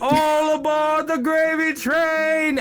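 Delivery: high, drawn-out conductor's call; high sustained fairground train call; automated train announcer. Acted. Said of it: "automated sound effects of the gravy train".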